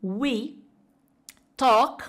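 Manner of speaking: each word apart with pauses. Shown as a demonstration. Teacher drilling the next phrase word by word. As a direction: drill slowly, one word at a time. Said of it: "fala comigo em voz alta".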